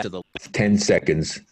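loud bursts of uneven volume. Real, not acted. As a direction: audio jumping loud and back. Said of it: "your audio keeps switching like that".

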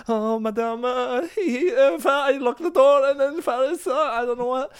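high, breathless, near tears; panicked nanny. Retold or imitated. Nanny phones the mother in a panic, high and near tears. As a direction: stammer out the bad news.

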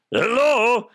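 high goofy hello; derailed Arnold voice. Acted. Host re-trying the runaway Arnold voice, high holler. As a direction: call it out in a botched, silly voice. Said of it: "fucking voice".

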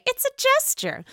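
high-pitched quoted catchphrase voice; Shannon Beador. Retold or imitated. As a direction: put on a very high imitation voice. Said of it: "As Shannon Beador would say".

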